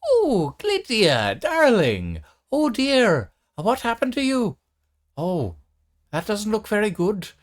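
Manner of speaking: put-on high, loud doting old granny; grandmother voice. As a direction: coo over her like a fussing grandma. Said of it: "squints a little and goes".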